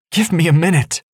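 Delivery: high, loud desperate plea; crying out. Acted. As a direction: cry it out in frustration. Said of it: "Angelita cried".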